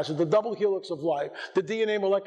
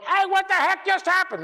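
high-pitched, louder cry of disbelief; mock bewildered yell; 11 semitones higher, 4 dB louder than usual. Preacher mimicking a startled newcomer in heaven, mock bewildered shout. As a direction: blurt it out like a startled bystander. Acts out what nobody was shouting.

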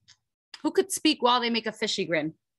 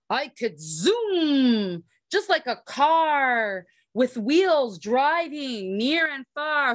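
plain reading voice

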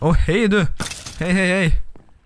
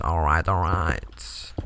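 much lower, softer, slow drawl; mock deep drawl; 9 semitones lower, 6 dB quieter, much slower than usual. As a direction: stretch it out low and lazy. Drawn-out low mock voice.

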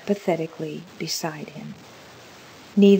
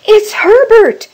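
high, slow, very loud shriek; scream; 14 dB louder, slower than usual. A narrator's reading. Mother realising her dead son knocks, high scream. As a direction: scream it out wildly. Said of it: "she screamed".

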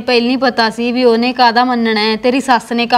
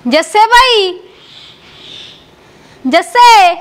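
loud, higher call across the house; 7 semitones higher, 5 dB louder, much slower than usual. Acted. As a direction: call his name as if he is out of sight. Sister calls out for her brother.

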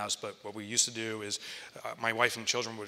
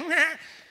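mock laugh; 8 dB louder than usual. Accountant laughing at joke.

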